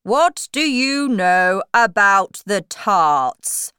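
slow, loud interrogation; storybook king. Acted. King questioning Alice, slow loud regal voice. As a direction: question loudly and slowly as king. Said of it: "the king asked Alice".

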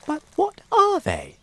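put-on high, wide-eyed boy; a scared little boy asking; young boy voice. Frightened boy asks.

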